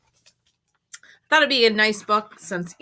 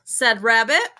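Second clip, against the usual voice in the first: usual speaking voice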